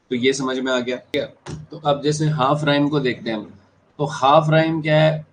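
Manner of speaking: ordinary delivery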